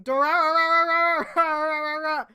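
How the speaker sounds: high, loud rapid Dorarara; mock battle scream. Reader voicing the rider's attack scream, mock battle yell. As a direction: rattle off Dorarara like a fighting cry. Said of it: "complete with a screaming".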